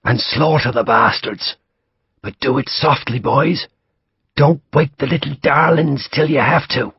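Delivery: high, pressing Irish command held back; urgent quiet order. Acted. Irish major hushing his men forward, urgent. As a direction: urge the men on without raising the voice. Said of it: "still spoke quietly".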